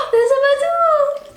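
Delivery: high, slow, drawn-out amazement; surprised gasp. Real, not acted. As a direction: gasp and stretch the word out. Gasp at yogurt name.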